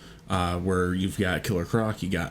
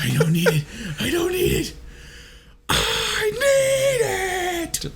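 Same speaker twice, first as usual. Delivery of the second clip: high strained laughing whine, building to a long wail; hammed-up, squeaky plea, last one drawn out; mock-desperate parody; slower than usual. Parody of gasping for water.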